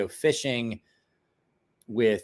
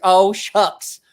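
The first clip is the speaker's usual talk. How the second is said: mock innocent pal; 3 semitones higher, 7 dB louder, slower than usual. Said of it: "He's like".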